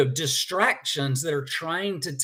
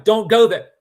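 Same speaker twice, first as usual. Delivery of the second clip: raised, urgent commands; guide shouting orders; 6 semitones higher, 6 dB louder than usual. Retold or imitated. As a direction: bark directions from the guide. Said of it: "'cause he's going".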